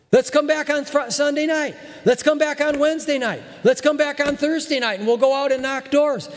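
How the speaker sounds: imitating an old-time preacher's rallying call; old-time pastor calling. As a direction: urge the flock back night after night. Pastor of old days calling people.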